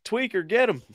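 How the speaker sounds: high put-on Southern holler; egging them on in a hammed-up Southern twang; mock Southern accent. Host slips into the Southern accent again while watching. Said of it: "there I go with the accent again".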